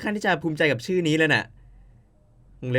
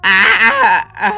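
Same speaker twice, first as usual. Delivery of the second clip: loud blissful moan; 6 semitones higher, 14 dB louder than usual. Acted. Blissful moan, rubbing the cat.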